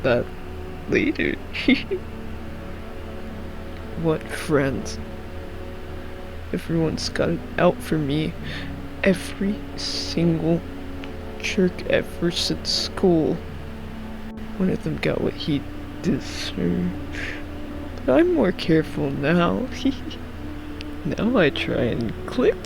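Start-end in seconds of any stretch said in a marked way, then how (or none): 1.4-4.0 soft tittering laugh; giggle. Acted. Visitor putting off talk of bad times, giggling. 19.7-21.0 brief soft titter; giggle. Acted. Visitor hinting someone got what he deserved, giggling.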